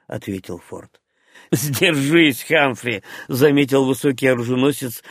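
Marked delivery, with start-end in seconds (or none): none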